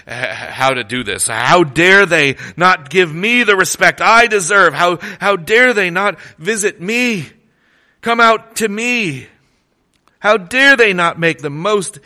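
sulk, then swell into a proud demand; proud Naaman. Retold or imitated. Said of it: "Naaman's pride".

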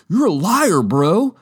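hammed-up skater calling him out; mock accusing bro. Skaters accusing the liar, high mock anger.

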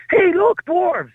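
put-on loud, very high child's shout; child blurting out. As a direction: imitate a tactless kid calling out. Imagined child blurting across pub.

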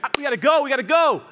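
high, loud, excited outburst; over-eager. Acted. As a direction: blurt it out like you can't wait. Acts out over-eager vision receiver.

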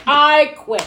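emphatic resignation. Retold or imitated. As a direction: announce it with a dramatic punch. Acted agent dropping the client.

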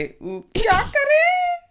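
high, squeaky "what to do"; confused heroes. Acted. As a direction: squeak it out helplessly. Heroes looking to Rita, confused.

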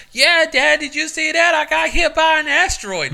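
put-on high, loud, thrilled child; excited kid voice. A kid bragging to dad after being hit, high and loud. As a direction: squeal it like an unhurt proud kid. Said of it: "He's like".

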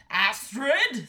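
loud astonished exclamation; astonished king. A narrator's reading. King seeing the daughter land, astonished and loud. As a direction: cry the name out in disbelief.

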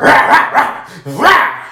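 rising, drawn-out rah sounds; mock effort grunts. Imitating vigorous effort.

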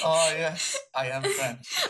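hint of a French waiter. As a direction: imitate the waiter's self-satisfied line. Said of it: "He's the French waiter".

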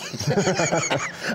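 amused belly laugh. Real, not acted. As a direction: laugh heartily at your own joke. Laughs at own joke.

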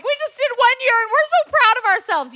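high, loud gushing boast; proud newlyweds. Retold or imitated. Young couples in the room boast of one year, proudly gushing. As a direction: imitate a couple thrilled with themselves.

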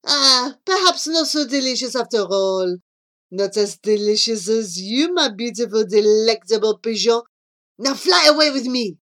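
French suitor, let down then amorous; Jean-Claude. Acted. Jean-Claude after pecking gravel, turning back to ardent wooing. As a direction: shrug it off and woo, building to a loud plea.

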